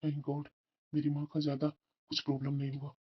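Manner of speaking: quiet relief. Acted. Son hearing his mother has no fracture, quietly relieved. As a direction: breathe thanks under your voice.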